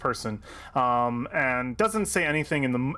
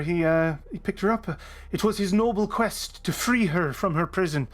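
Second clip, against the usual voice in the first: raised, lilting Irish character voice; Biff; 4 semitones higher than usual. Acted. Biff explaining Starfallen to Victor, Irish accent. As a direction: tell her story in a high Irish accent. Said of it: "no, that's the voice".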